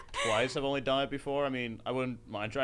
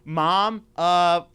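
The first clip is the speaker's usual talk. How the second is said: higher, louder mock schoolgirl, dragged out; middle school girl; 5 semitones higher, 9 dB louder, much slower than usual. Caught girl calling her parents about shoplifting, mock sheepish. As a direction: imitate a sheepish kid confessing on the phone. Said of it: "made one of the girls call".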